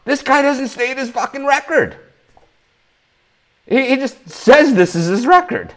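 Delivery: real frustration boiling over; upset rant. Real, not acted. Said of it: "I'm upset".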